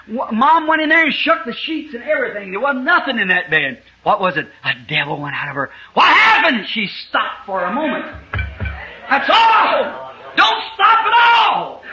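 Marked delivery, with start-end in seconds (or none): 5.9-11.8 high shouted climax, slowing at the end; fervent pulpit shout; preaching shout. Real, not acted. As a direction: bring the sermon to a shouting peak.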